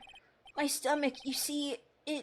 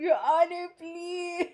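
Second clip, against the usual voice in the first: mock crying plea; 5 semitones higher, slower than usual. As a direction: fake a tearful beg, half laughing. Fake pleading, laughing.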